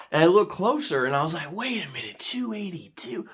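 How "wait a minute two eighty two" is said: soft, higher hushed question; puzzled realisation. Acted. Bidder rereading the result, hushed disbelief. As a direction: hush it as it dawns on you.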